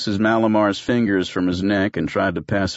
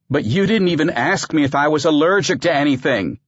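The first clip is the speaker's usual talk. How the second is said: high, louder outraged complaint; high-pitched indignant objection; indignant protest; 8 semitones higher, 4 dB louder than usual. Acted. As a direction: protest it as the wronged patient. Malamar protests the injection.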